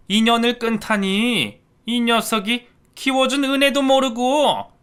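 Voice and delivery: loud, high affronted scolding; outraged. Acted. Stepmother outraged at her stepson's threat. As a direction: take offence loudly.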